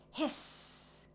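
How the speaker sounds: soft breathy little hiss; high soft owlet hiss; baby owl hiss. A narrator's reading. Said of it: "hissed the baby owls".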